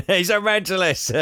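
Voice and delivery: high-pitched, through real laughter; laughing echo. Real, not acted. Co-host echoing a joke about psycho Jerry, laughing. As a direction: repeat the joke, cracking up.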